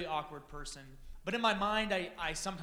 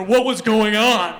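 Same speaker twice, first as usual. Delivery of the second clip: much louder, slow, heavy put-on voice; Russian lady bodybuilder; 4 semitones higher, 19 dB louder, slower than usual. Joking that Kim sounded like a Russian bodybuilder, loud and slow. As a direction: boom it out like a hulking bodybuilder. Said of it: "she was a Russian, like, ladies bodybuilder".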